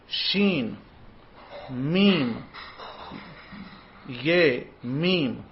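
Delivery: Shin, Mim, Ye, Mim, each held and set apart; one drawn-out sound at a time; sounded-out syllables. Shown as a demonstration. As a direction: intone "Shin, Mim, Ye, Mim" slowly with long pauses. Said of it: "these are the sounds that you're supposed to say".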